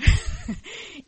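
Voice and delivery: quick burst of laughter at her own joke; a sudden loud breathy laugh; short laugh. Real, not acted.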